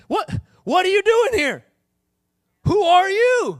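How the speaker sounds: high, loud, startled demand; indignant confrontation. Acted. Confronting a stranger found at home.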